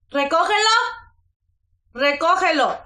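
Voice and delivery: mother orders her daughter to pick it up, sharply; firm command. Real, not acted. Telling child to pick up.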